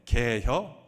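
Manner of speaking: short, loud Chungcheong dialect "개혀?". Retold or imitated. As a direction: fire off "개혀?" loud and terse, Chungcheong style. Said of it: "이러고 끝난다는 것입니다".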